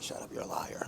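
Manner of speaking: a whispered, playful shut up; wife's whispered brush-off. Retold or imitated. Wife dismissing her husband's compliment, whispered and teasing. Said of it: "And she's like".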